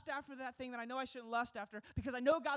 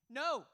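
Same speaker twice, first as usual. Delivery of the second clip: a single firm "No.", higher and louder; emphatic no; 4 semitones higher, 5 dB louder than usual. Shown as a demonstration. Pastor showing the Greek's emphatic no to the congregation, higher and louder. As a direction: land "No." like a door slamming shut. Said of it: "it's an emphatic no".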